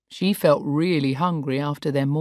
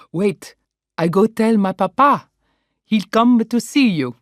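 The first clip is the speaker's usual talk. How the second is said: higher, excited Italian waiter; Italian accent; 7 semitones higher, 6 dB louder than usual. Acted. Waiter reacting to the birthday news, excited Italian accent. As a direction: gush like an Italian son.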